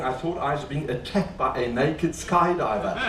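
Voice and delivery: ordinary talk, nothing marked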